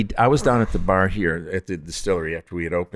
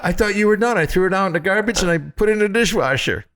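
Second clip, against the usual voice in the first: mother's voice; 12 semitones higher than usual. Retold or imitated. She goes.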